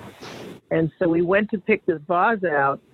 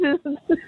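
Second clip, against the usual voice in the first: giggle; 10 semitones higher than usual. Real, not acted. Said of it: "Oh, geez".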